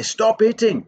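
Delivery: high, loud telling-off; mock scolding. Shown as a demonstration. Teacher showing children what not to say to a hitter, loud scolding. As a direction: snap out a high, loud command. Models the wrong thing to say.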